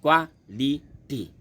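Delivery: Quality slowly in three spaced beats; syllable by syllable. Shown as a demonstration. Teacher repeating for students, quality split in three. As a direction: give each syllable of quality its own beat. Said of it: "ये तीन शब्द है".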